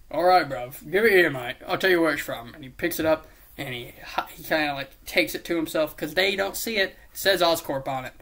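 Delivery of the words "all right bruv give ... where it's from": loud, hammed-up British geezer; put-on British accent. Osborn asking the kids for the bomb, mock British accent. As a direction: drop back into a mock British "bruv" voice. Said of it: "doing accents to change it".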